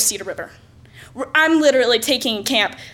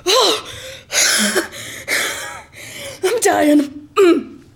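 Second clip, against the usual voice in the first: mock exhausted; 7 semitones higher, much slower than usual. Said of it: "I'd be".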